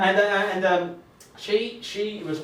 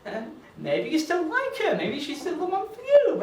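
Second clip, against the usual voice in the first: inner voice; 11 semitones higher than usual. Retold or imitated. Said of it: "intense brain was like".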